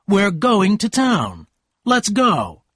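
hard voice. A narrator's reading. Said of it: "he said in a hard voice".

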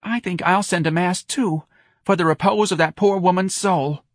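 high, emotional pity; overcome. Acted. Woman offering a mass for the dead, overcome. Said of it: "overcome by old lady Lopez's biography".

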